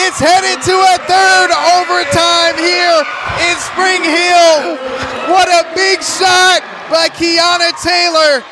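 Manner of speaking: loud, high, slow play-by-play call of the tying shot; thrilled announcer yelling; excited shout. Real, not acted. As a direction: shout it out as the big shot drops. Buzzer shot ties game, excited call.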